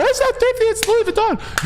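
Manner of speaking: very high, indignant mock girl; offended girl voice. Imitating the girl insisting it's Louis Vuitton, high and indignant. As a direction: squeal it like a girl defending her bag. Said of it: "And she goes".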